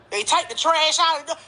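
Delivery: ordinary talk, nothing marked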